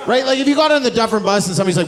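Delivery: excited rush. Real, not acted. Excited fast build-up.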